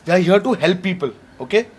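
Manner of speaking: ordinary talk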